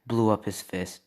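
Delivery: ordinary delivery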